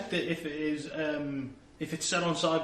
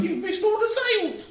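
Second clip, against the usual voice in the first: high, loud, squeaky Cockney urchin; small Cockney child; 7 dB louder than usual. Acted. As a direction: pipe up as an eager little Cockney kid. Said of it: "him to be a small Cockney child".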